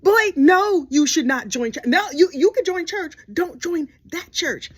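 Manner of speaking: loud, high warning; emphatic no. Real, not acted. Comedian telling James not to join, loud and high. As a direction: shout him down.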